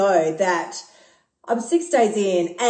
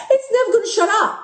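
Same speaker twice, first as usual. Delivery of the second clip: laughing; 10 semitones higher, faster than usual. Real, not acted. Laughing about her nagging inner voice.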